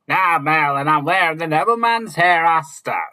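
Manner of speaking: loud, high pirate shout; pirate exclaiming. A narrator's reading. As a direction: bellow it like a swaggering captain. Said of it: "Captain Greenock exclaimed".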